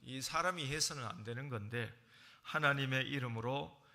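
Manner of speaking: usual speaking voice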